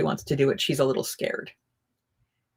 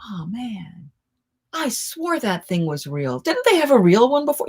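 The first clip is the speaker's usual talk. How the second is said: imitating teenage boy; 5 semitones higher than usual. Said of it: "and will go".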